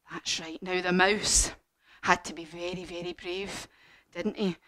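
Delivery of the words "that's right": ordinary delivery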